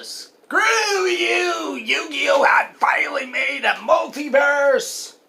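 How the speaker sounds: high, slow, drawn-out mock shout. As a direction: yell it out in mock defiance. Mock outburst at critics.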